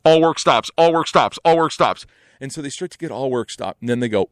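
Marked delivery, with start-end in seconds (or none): 0.0-2.1 high, loud urgent call over the radio; walkie shout. Retold or imitated. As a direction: bark it down the walkie-talkie. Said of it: "ridiculous things coming out of the walkie-talkies".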